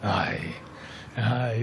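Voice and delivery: put-on low, soft Scottish accent; a token attempt at a Scottish accent. Pundit obliging the host's request, in a mock Scottish accent. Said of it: "do it in a Scottish accent".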